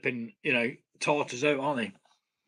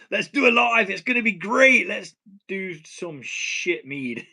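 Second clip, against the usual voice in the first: self-mocking hype; 5 semitones higher, 5 dB louder than usual. Home brewer mocking his own livestream plan to viewers, mock-excited. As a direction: hype it up brightly, then undercut yourself.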